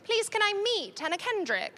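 high-pitched imitation of a begging daughter; pleading child. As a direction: beg like an excited little girl.

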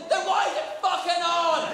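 exasperated. Acted. Ranting at Aga owners.

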